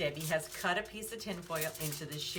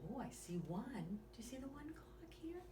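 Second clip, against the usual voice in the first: put-on lively bedtime story read to a small child; reading to children; 3 semitones higher, 7 dB quieter than usual. Reading a bedtime story to young listeners, lively and gentle. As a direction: read it like a bedtime picture book. Reads picture book aloud.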